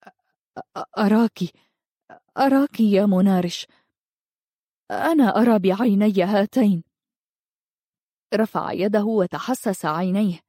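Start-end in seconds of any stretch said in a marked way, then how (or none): 0.1-6.8 stammering, overwhelmed astonishment; trembling with emotion. A narrator's reading. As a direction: falter on the first word, then marvel in halting phrases. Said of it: "قال وهو يختلج". (متلعثمًا من شدة التأثر والذهول)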